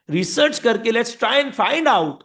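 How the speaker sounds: high, loud and drawn-out suggestion; eager proposal. Acted. Company suggests researching what customers want, eager proposal. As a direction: pitch the plan with gusto.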